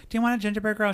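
high, sugary offer of a cookie; sweet gingerbread girl. Retold or imitated. Gingerbread girl offering Darcy a treat, high and sugary. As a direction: pitch way up like a chirpy gingerbread girl. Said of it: "and the gingerbread".

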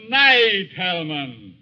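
gloating goodbye. Acted. Villain's gloating farewell.

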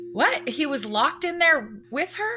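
high-pitched, incredulous question; baffled disbelief. Real, not acted. Baffled by a detail just read.

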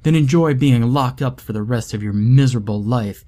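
loud, spitting contempt; angry threat. Acted. Son threatens his father, loud and furious. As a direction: hurl the insult.

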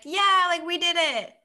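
high, excited mock cheer; loud, lifted voice, acting out a happy crew; celebrating cheer. The crew drinking on the ship after their success, celebrating.

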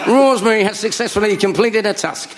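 high put-on voiceover with suppressed laughter; reality-show narrator. Narrator reporting a contestant's task, mock voiceover. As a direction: announce it like a TV narrator.

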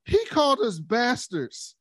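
put-on high, slow tattling kid; tattling child. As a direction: tell on them like a hurt child. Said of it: "Jace goes".

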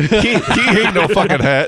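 high, hammed-up kid voice; mock kid voice. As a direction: mimic a bratty kid.

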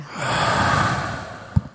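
raspy breath drawn through the throat; throat breathing demo. Shown as a demonstration. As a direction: demonstrate a slow hissing ujjayi breath. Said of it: "breathe in through your nose and through your throat".

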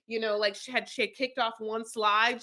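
normal talking voice

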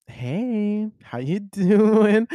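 high, drawn-out, hammed-up come-on; mock seductive. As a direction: stretch it out slowly, high and flirty, breaking into a laugh. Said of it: "she tries to seduce him".

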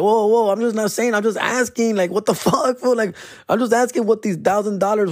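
high-pitched, backing-off self-defense; defensive protest. Retold or imitated. Defending himself to offended workers.

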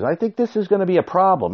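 a light mock of his wife; imitating wife. Wife on the phone warning him, high mock-worried voice. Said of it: "she says".

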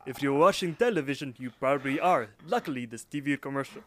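put-on loud, hammy Russian accent. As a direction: read it like a Russian TV pitchman. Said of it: "you gotta do the accent".

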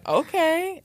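imitated onlooker, pitched high; a high, questioning okay; bemused okay. Cameraman reacting to a star's sudden riffing, bemused. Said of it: "the guy was going".

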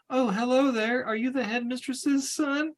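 put-on high, sing-song hello to a child; sweet greeting. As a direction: coax the little boy in a high sweet voice.

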